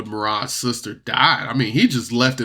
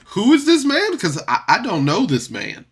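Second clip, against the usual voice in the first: pitched way up and louder, bewildered; sudden high startled "who is this man"; baffled outburst; 9 semitones higher, 4 dB louder than usual. Retold or imitated. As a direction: play the stunned friend who recognises no one. Pickles baffled by disguised brother.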